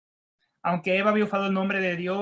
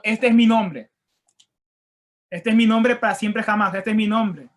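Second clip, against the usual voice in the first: louder, firm proclamation of God's words; proclaiming; 6 dB louder than usual. Acted. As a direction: declare it like God announcing his name. Proclaims God's declaration emphatically.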